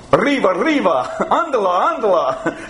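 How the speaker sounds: hammed-up cartoon mouse cry, quick and rising; tossing off a playful Speedy-style shout; cartoon Spanish. Preacher jokingly shows the congregation Spanish for quickly, mock cartoon cry. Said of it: "You know how to say quickly in Spanish".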